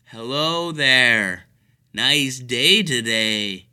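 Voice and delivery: very slow, loud over-enunciated greeting; slow patronizing talk. Retold or imitated. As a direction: drag each word out as if to a child. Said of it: "talk slower to people in wheelchairs".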